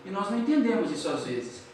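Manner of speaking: ordinary talk, nothing marked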